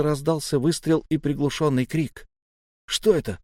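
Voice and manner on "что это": loud, high-pitched outraged demand; fast, indignant outburst. A narrator's reading. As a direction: protest hotly at being handed junk. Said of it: "возмутился Галай".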